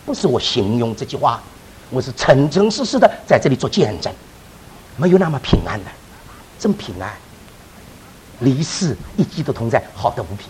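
hushed, heartfelt witness of peace; sincere testimony. Real, not acted. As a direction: bear witness quietly and sincerely.

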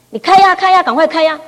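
high, loud, eager pushing; eager urging. Retold or imitated. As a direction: urge mom to open it now. Daughter urging mother open gift. (女儿兴奋地催促：高声急切)

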